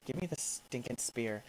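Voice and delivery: normal talking voice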